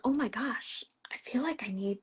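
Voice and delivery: hushed, whispered realisation; retold moment of awe, under the breath; awed whisper. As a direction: whisper it as if it just dawned on you.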